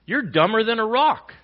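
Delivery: loud, high, slow mocking jab; mock scolding quote. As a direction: bark it like a know-it-all scolding. Pastor voices quoted speaker.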